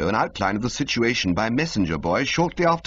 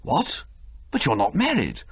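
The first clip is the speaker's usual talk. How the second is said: higher startled exclaim; astonished toff; 7 semitones higher than usual. Acted. Bertie to Old Little, higher astonished toff voice. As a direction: caught off guard.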